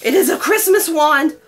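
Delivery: high, loud squealing delight; can't contain the joy over the wand; thrilled. Real, not acted. Unboxing a dream Harry Potter wand.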